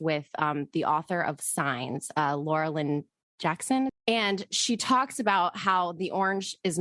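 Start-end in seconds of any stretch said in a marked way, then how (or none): none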